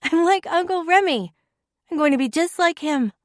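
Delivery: put-on high, loud child's voice; a proud small girl bragging; excited little girl. Little girl boasts to adults.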